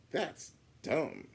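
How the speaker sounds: put-on a bratty kid sneering at the idea; scoffing Biff. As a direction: brush her idea off.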